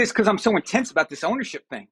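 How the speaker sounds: normal talking voice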